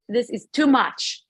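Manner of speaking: ordinary talk, nothing marked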